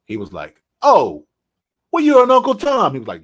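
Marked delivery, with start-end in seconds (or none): 0.8-2.9 high, loud jab at the reporter; mock loud retort. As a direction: fire back the insult loud and high. Don King retort in movie scene.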